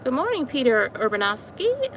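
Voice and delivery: normal talking voice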